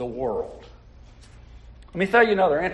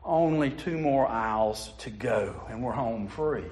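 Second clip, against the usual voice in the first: gentle; 7 semitones lower, 6 dB quieter than usual. Acted. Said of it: "The mom said gently, calmly".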